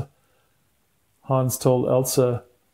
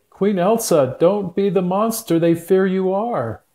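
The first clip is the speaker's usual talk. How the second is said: higher, louder calling out; crying out; 6 semitones higher, 5 dB louder than usual. Acted. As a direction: call out to her, pleading. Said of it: "Hans cried out to her".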